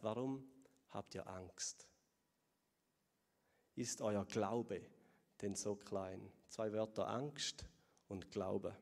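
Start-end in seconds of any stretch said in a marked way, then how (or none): 0.0-6.3 much softer and lower, slow; Jesus quietly questioning his disciples' fear; soft gentle question. Acted. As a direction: ask it almost tenderly. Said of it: "Er sagt".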